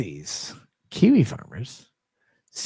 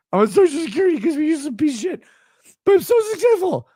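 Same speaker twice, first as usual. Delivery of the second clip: high squeaky mocking voice, fast; pitched-up sneering imitation; mock whiny fan; 12 semitones higher, 4 dB louder, faster than usual. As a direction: ham it up to ridicule. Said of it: "Texting me this".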